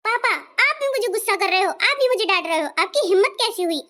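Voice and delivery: outraged daughter's rising complaint; indignant outburst. Acted. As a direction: flare up at being scolded. Daughter rages at father for scolding her.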